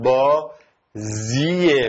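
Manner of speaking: با زیه said very slowly in two parts; slow syllable split. Shown as a demonstration. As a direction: stretch با and زیه apart slowly. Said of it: "میگفتم با زیه".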